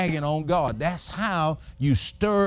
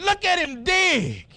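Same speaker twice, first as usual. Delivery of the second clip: excited; 10 semitones higher, slower than usual. Retold or imitated. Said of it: "I said".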